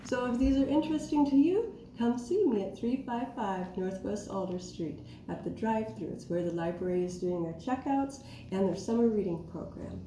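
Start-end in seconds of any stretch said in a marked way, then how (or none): none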